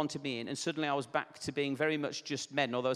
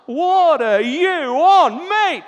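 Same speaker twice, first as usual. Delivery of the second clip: high, very loud, drawn-out heckle; imitated bloke yelling in outrage; incredulous shout; 11 semitones higher, 14 dB louder, much slower than usual. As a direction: bellow it slowly in mocking disbelief. Retold incredulous shout from van driver.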